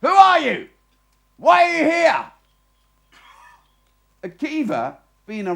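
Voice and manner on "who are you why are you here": high, loud challenge from the turret; guard calling down. Acted. Sentry shouting down at the wandering rabbi, loud high challenge. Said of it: "shouts again".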